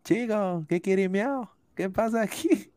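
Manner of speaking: high-pitched mock Puerto Rican accent; badly faked Puerto Rican accent. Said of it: "señor, eso es Puerto Rico".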